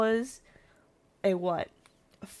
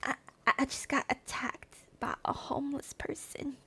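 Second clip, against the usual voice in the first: whispered, hushed; whispered confession; 5 semitones higher, 9 dB quieter than usual. Retold or imitated. To the desk lady, a whispered report. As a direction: lean in and whisper it. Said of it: "And I'm like".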